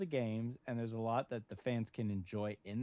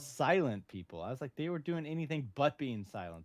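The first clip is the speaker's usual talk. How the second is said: ordinary delivery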